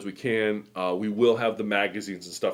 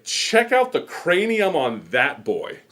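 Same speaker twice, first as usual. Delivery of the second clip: slow, higher mock uncle voice; imitating uncle; 8 semitones higher, 5 dB louder, much slower than usual. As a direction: drag the words out as the amused uncle, pitched up. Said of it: "and he's like".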